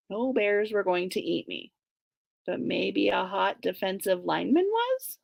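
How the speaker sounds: ordinary delivery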